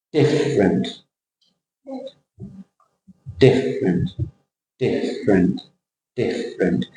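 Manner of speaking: different with a big DIFF and a small, dropping -rent; big-then-small word shape. Shown as a demonstration. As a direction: hit DIFF, let -rent fall away in different. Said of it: "you're getting the shape of the word".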